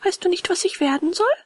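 put-on high-pitched, eager boy puppet. A narrator's reading. Puppet boy asks his friend about tomorrow, eager high voice. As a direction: pitch it up like an excited little puppet.

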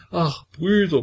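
high, tearful cry of Brüder falling away; sobbing lament. A narrator's reading. Petka crying out to the brothers, high and tearful. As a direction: wail Brüder like a frightened child. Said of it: "wie ein Kind schluchzend zu sprechen".

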